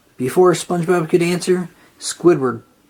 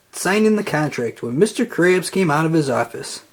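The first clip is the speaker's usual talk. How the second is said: plain reading voice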